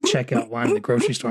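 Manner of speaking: usual speaking voice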